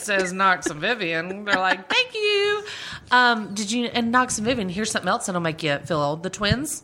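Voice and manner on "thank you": mock thanks.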